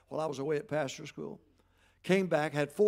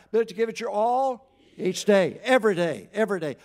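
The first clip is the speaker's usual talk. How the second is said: louder recitation, pushing each word out; 5 semitones higher, 10 dB louder than usual. Real, not acted. The congregation told to say it louder, so it comes back loud. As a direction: say it again, with more force.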